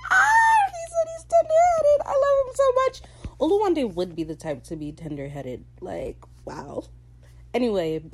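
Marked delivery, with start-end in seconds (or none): 0.1-3.0 very high, loud, laughing squeal; delighted fangirl screaming. Real, not acted. Delighted fan reaction to quoted line. 5.8-6.8 hushed, voiceless whisper; awed whisper. Real, not acted. Awed aside after the joke.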